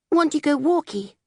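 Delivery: put-on high, babyish little voice; baby talk. A narrator's reading. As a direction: say it like a toddler. Baby speaking.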